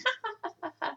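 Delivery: high cackling laugh; high jeering giggle; high mocking laugh. A narrator's reading. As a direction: laugh at the costume. Dogs laugh at Oscar.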